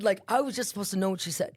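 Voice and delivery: ordinary delivery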